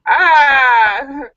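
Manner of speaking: loud laughing cry; laughing shout. Real, not acted. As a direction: burst out laughing loudly. Reaction to the joke.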